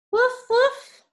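high yappy puppy woof; puppy bark. Acted. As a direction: bark like a small happy dog. Dog barks in the story.

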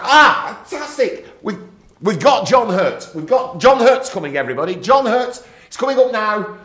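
loud, high excited yelling; excited shout. Retold or imitated. Crew member yelling to the box about the guest, excited shout.

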